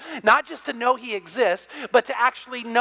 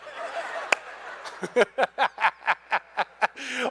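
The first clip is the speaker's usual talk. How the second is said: laughing; 3 dB quieter than usual. Real, not acted. Laughs at own joke.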